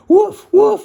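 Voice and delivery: loud, very high barks; loud high woof woof warning barks; barking. Acted. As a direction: bark out a sharp alarm. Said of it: "she barked".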